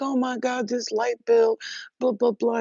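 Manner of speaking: high, loud hammed-up worry over the light bill; high, loud mock distress; mock fretting. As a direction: whine like the bill is the end of the world. Mocking someone stressed by bill.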